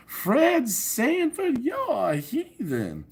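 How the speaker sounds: put-on high, slow scolding old lady voice; mock Aunt Esther. Quoting the sitcom aunt scolding Fred, high and slow. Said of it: "I always think of her".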